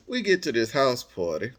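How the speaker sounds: usual speaking voice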